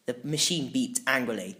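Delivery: usual speaking voice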